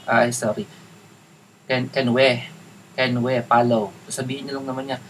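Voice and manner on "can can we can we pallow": Tagalog-accented English, "follow" as "pallow"; bad Tagalog accent. Shown as a demonstration. Coach rehearsing a request to the client, clumsy Tagalog accent. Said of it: "Pangitan mo yung accent mo".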